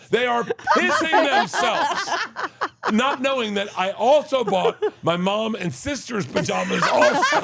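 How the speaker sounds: real laughter through the punchline; laughing. Real, not acted. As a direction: lose it while telling. Teller cracking up.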